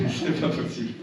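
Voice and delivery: laughing through the words, voiceless; amused laughing. Real, not acted. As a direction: say it breaking up with laughter. Amusement collectif sur Jean Lassalle. (en riant, amusé)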